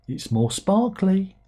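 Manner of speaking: high, slow, teasing seller; mock sales pitch. Seller tempts a reluctant fan, mock sales pitch. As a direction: dangle it slowly in a high voice.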